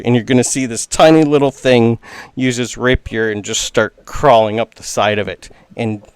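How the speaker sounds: ordinary delivery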